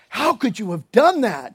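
aghast pagan sailors demanding an answer; shocked sailors. Retold or imitated. Sailors confront Jonah, mock shocked disbelief. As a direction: throw up your hands at what he did. You're in a pretty bad way when they're like.

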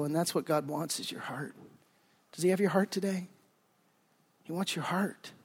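soft, sincere closing plea; intimate hush. Real, not acted. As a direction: draw them in quietly. Tender sermon climax.

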